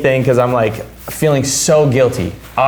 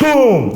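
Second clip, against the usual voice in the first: a quick sound effect of someone hitting the floor; imitated crash; 11 semitones higher than usual. Retelling cousin collapsing.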